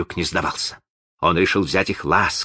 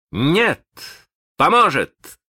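higher, louder defiant answer; defiant retort; 9 semitones higher, 4 dB louder, slower than usual. Acted. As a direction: fling back the reply. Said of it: "услышал он в ответ". (дерзкий ответ)

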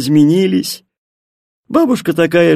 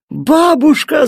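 put-on high, louder girl's call; excited cry; 11 semitones higher, 4 dB louder, slower than usual. A narrator's reading. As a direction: call out eagerly to grandmother. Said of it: "закричала Миттель". (радостный крик)

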